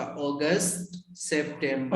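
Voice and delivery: अगस्त drawn out slowly as "AW-gust", then सितंबर as "sep-TEM-ber"; careful teacher's model of अगस्त and सितंबर, "aw-gust, sep-tem-ber"; model pronunciation. Shown as a demonstration. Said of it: "गलत हो गया यहाँ से आपका".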